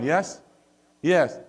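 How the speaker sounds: ordinary talk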